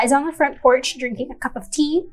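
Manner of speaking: ordinary talk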